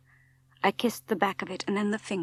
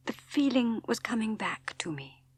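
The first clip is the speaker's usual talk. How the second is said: plain reading voice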